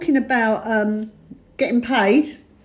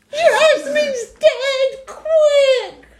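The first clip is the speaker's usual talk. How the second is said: very high, long-drawn mock wail; mimicked panic; much slower than usual. Teasing the one who raised the alarm, high mock panic. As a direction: imitate a melodramatic alarm, stretched out.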